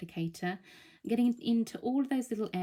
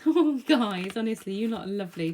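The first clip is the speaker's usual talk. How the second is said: laughing; 4 semitones higher, 7 dB louder than usual. Real, not acted. Touched by viewers' kind messages.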